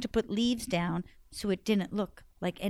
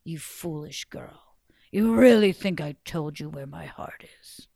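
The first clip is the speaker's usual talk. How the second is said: low whispered sneer; sneering giant; 5 semitones lower, 6 dB louder than usual. Acted. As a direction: hiss out the scorn.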